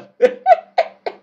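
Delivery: high breathy burst of laughter. Real, not acted. Gamer laughs at his own joke.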